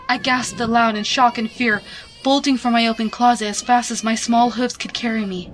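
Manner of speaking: plain reading voice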